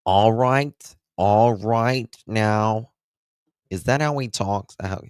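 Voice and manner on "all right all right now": slow, drawn-out Nova Scotian voice, louder and higher; Dirtwood impression. Streamer imitates Dirtwood's Nova Scotian drawl for chat, higher and louder. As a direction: stretch it out like the guy's Nova Scotian voice. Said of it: "how does Dirtwood say? How does he sound?".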